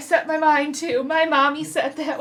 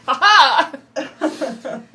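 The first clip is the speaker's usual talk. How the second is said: imitating Alistair laugh; 7 dB louder than usual. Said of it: "you're like".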